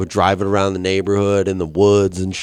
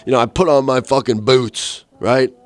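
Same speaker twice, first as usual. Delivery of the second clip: ordinary delivery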